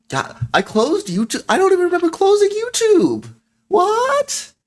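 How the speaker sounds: high, loud shriek of disbelief; shocked disbelief. Real, not acted. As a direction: cry out, pitch shooting way up. Finds YouTube unexpectedly closed.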